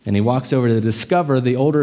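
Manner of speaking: ordinary talk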